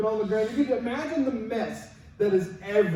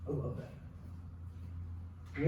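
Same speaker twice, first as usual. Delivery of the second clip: slow, breathy whisper, much softer; slow hushed whisper of admiration; whispered aside; 7 semitones lower, 15 dB quieter, much slower than usual. Real, not acted. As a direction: lean in and whisper your delight. Hushed aside about Elijah.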